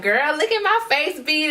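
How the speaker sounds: high-pitched excited squeal of a friend; excited girlfriend texts. Retold or imitated. Backup singer texting about her makeup, excited. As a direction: gush it high, like a thrilled girlfriend. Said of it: "backup singers, like".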